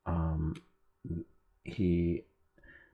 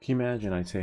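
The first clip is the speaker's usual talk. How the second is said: ordinary talk, nothing marked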